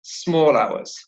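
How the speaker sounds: "small" stressed, its L running into "hours"; "small" held long, sliding straight into "hours"; stress on SMALL, linked. Shown as a demonstration. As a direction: join "small hours" as one unit, weight on "small". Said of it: "there's a stress on the word small and there's a liaison".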